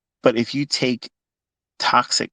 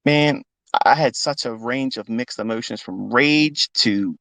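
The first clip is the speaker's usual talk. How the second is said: ordinary talk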